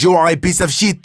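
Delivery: mock angry French accent; hammed-up French insult. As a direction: curse him out in a thick French accent. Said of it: "He had a French accent".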